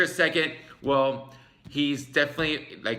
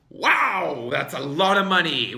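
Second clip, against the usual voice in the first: louder, higher amazed exclamation; shocked wow; 6 semitones higher, 7 dB louder than usual. Real, not acted. Reacting to the huge contract, loud amazed exclamation. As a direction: gasp it out, stunned by the number.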